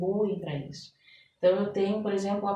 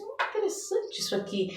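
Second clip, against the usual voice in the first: quoting herself; 14 semitones higher, 5 dB quieter than usual. Retold or imitated. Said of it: "eu falei assim".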